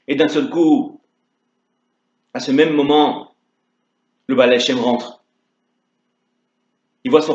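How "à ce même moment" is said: usual speaking voice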